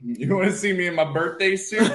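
joking through laughter; laughing flirt. Pretend. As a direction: tease while laughing.

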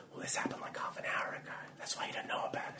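very soft, breath-only explanation; acted secretive whisper. Explaining to the friend who arrived late, secretive whisper.

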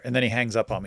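ordinary delivery

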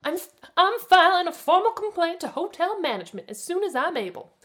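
high, loud indignant threat; snarling. A narrator's reading. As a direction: snarl it like an outraged rich guest. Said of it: "Melinda snarled".

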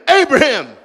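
loud, high call of God's voice; God summoning Abraham. Acted. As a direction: call his name from on high.

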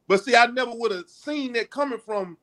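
ordinary talk

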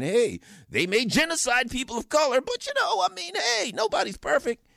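high, airy mock shrug of a voice; sarcastic mock excuse. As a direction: parody a voter brushing it off. Mocking Democrat voters' excuse.

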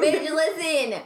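slow, high-pitched call for attention; emphatic interjection. Real, not acted. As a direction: drag it out to hush the laughter. Demands attention amid laughter.

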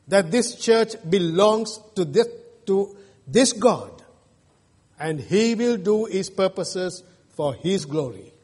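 usual speaking voice

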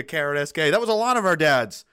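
ordinary delivery

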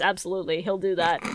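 normal talking voice

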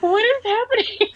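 high shocked squeal; high-pitched "what" of shock; surprised. Retold or imitated. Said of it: "I was like".

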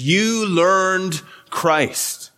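"You" drawn out and slow, very slow overall; stress on you. Shown as a demonstration. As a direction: lean hard on "You" in "You learned Christ". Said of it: "is doubly emphatic".

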